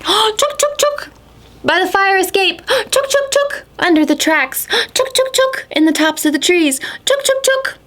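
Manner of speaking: very high urgent squeaky chatter with gasps; squirrel alarm call. Acted. Mama Squirrel warning the whole park, very high and urgent. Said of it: "went to raise the alarm".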